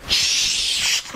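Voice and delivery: sharp urgent shush. Acted. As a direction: hush them quickly. Shushing friend in skit.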